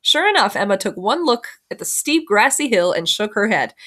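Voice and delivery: ordinary delivery, nothing marked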